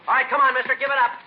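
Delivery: loud, high commanding shout. Acted. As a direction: yell after the fleeing gunman. Cop chasing fleeing bandit.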